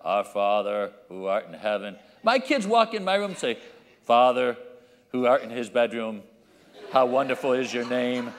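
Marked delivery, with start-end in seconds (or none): 0.0-2.0 low, droning prayer recital; silly religious recital. Shown as a demonstration. Churchgoer reciting a prayer, mock-solemn droning. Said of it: "so silly in the way". 4.0-8.3 low, droning mock prayer; silly religious recital. Kids addressing dad in his bedroom, mock-solemn recital. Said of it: "silly in the way of that people say their prayer".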